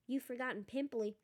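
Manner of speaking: usual speaking voice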